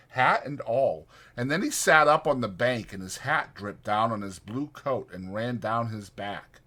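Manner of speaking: ordinary delivery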